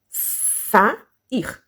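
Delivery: "sair" split into sa, ir; a clear gap inside "sair"; syllable by syllable. Shown as a demonstration. Teacher showing two syllables, "sair" said in separate beats. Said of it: "duas sílabas".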